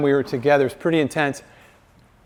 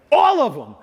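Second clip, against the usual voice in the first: emphatic high burst; 11 semitones higher, 4 dB louder than usual. Real, not acted. Preacher answering own question.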